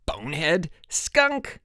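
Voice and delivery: high yelled name-calling; screamed insults. A narrator's reading. Harold and Mike trade names, screaming. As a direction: hurl each word separately.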